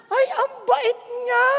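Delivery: high, drawn-out mock swoon; mock gushing admiration. Caught driver praises the lenient police officer, mock gushing.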